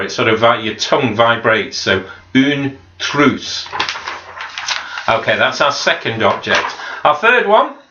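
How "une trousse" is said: une trousse with the R rolled; a slow une trousse, rolling the R. Shown as a demonstration. Teacher repeating une trousse slowly, rolling the R. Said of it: "we kind of roll the R".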